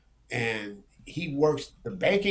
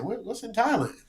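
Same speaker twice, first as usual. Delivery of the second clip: higher, baffled question; puzzled surprise; 7 semitones higher than usual. Retold or imitated. Answering the friend's Thailand retirement plan, baffled and higher. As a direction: ask it like the idea makes no sense.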